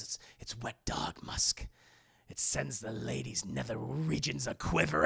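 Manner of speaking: mock-seductive breathy murmur; creepy whisper. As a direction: whisper it like a sleazy flirt. Acting a creepy flirt's line.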